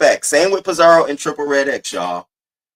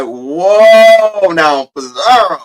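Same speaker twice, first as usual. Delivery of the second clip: much higher and louder, slowed whoa; a big startled whoa, reined in slowly; mock surprise; 7 semitones higher, 9 dB louder, much slower than usual. Retelling his reaction to Pizarro's outburst, loud and startled.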